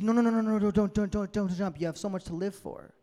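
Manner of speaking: high, loud rapid-fire panic; urgent pleading. Acted. Man on a bridge begging a stranger not to jump, urgent pleading. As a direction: plead frantically, words tumbling out. Acting a man stopping a jumper.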